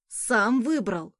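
slow, proud little boast; self-important. Acted. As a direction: puff up like a proud little boy. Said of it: "важно ответил ребёнок".

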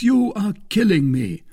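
high, loud protest; agonised cry. A narrator's reading. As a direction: cry it out in suspense. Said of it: "cried Aramis".

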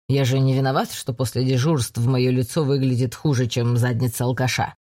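low, offhand brushing off; low male voice, downplaying; casual brother. A narrator's reading. Brother brushes off her worry. (небрежно отмахиваясь)

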